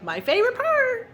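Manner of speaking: excited. Real, not acted. Eager daughter waiting to taste.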